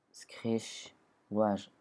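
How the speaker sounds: clumsy try at an English accent, building to a loud last word; attempted English accent. Shown as a demonstration. Gamer trying out English attack names, badly faked English accent. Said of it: "J'essaie de faire l'accent".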